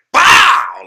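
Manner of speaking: huge loud high "Pow!"; mock explosion. Flashback at the auto parts store, shouted pow. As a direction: blast out an explosion noise. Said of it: "I just went like".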